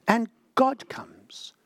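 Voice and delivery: whisper. Acted. Dramatic whispered climax of sermon.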